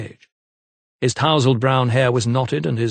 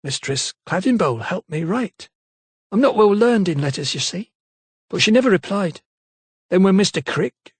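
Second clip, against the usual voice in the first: higher maid voice, fading at the end; maid trailing off; 8 semitones higher than usual. A narrator's reading. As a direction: servant girl who falters and trails away. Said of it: "her voice trailed off wanly".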